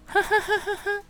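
sudden high-pitched cackle; high squeal laugh. Real, not acted. Podcaster lets out a squealing laugh at her own joke. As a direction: squeal out a laugh you find gross yourself. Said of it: "好，太恶了。".

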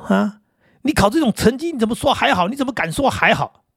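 high, indignant scolding; a father demanding answers over failed results; angry father. Retold or imitated. Father scolding his son who failed, high and indignant. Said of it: "他爸爸就很生气说".

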